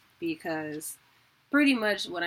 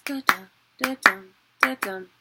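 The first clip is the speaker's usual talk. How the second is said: da-dum, da-dum punched louder, galloping beat; gallop rhythm; 7 dB louder than usual. Shown as a demonstration. Teacher showing horse gallop pattern, punchy. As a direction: drum the da-dum like hooves. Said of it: "horse gallop pattern".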